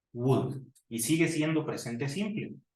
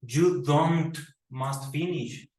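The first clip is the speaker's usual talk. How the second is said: slowly spelled-out wrong sentence; drawn-out incorrect example; 2 semitones higher, 4 dB louder, much slower than usual. Shown as a demonstration. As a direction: say it slowly, as the mistake. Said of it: "no decimos".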